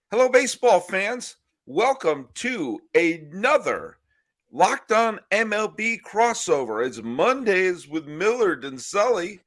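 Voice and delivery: bright, slow announcer welcome; show intro. Acted. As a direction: open the show like a host.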